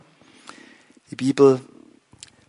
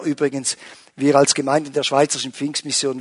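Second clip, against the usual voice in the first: ordinary talk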